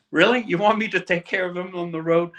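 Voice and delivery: put-on a stunned young player can't believe what the coach is asking; incredulous disbelief. Player reacting to the coach, with high excited disbelief. Retold disbelief at roommate request.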